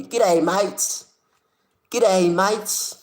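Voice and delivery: high, loud mock Australian accent; Aussie accent. Streamer greeting his Aussie viewer in a hammed-up Australian accent. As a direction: play up a broad Aussie greeting. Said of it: "The Aussies and their accents".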